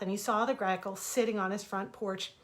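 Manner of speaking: plain reading voice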